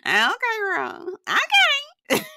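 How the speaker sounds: high mocking okays into laughter; laughing. Real, not acted. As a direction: sing out okay in disbelief, then crack up. Sarcastic okay then laughing.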